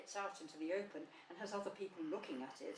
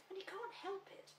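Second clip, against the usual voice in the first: very high, small voice; timid little Piglet; 13 semitones higher, 4 dB quieter than usual. Acted. Piglet excusing Tigger to the others, timid and high. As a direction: pipe up hesitantly, high and tiny.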